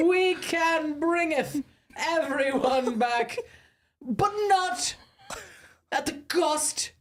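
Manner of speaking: high, slow, grandly stretched Shakespearean delivery; Shakespearean actor. Acted. Player performing an Avengers line as a Shakespearean play. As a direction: declaim it like a stage play, drawing out each phrase. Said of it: "Shakespearean. Like you're doing a Shakespearean play".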